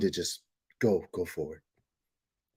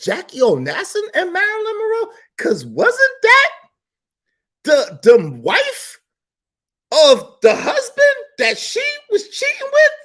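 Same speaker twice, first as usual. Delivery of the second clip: outraged disbelief; 3 dB louder than usual. Real, not acted. Outraged disbelief, crying sound.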